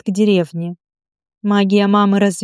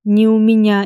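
plain reading voice

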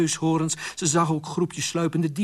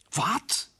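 high-pitched shocked question; alarmed; 12 semitones higher than usual. Acted. Queen hears thieves are loose, alarmed. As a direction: ask it aghast, pitch jumping up. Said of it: "vroeg Isabel verschrikt".

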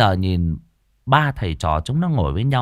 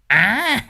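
high, gleeful cackle; cackling laugh; 11 semitones higher than usual. Acted. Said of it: "cười khanh khách".

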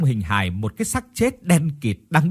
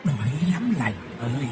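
leise, jammernde Geisterstimme; wailing ghost; 7 dB quieter than usual. A narrator's reading. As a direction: jammere leise wie ein Gespenst. Ghost Hoa wails hungrily.

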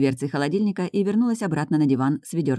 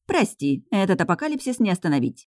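ordinary delivery

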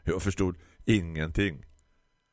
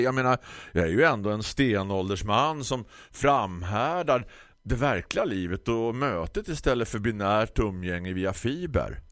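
ordinary delivery

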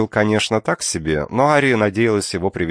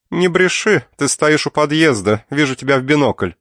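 scolding aunt; 8 semitones higher, 4 dB louder than usual. Acted. Aunt catches niece lying.

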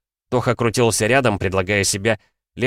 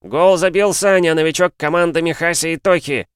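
football commentator; 9 semitones higher, 4 dB louder than usual. Acted. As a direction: announce it like a stadium commentator. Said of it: "объявил Никитос".

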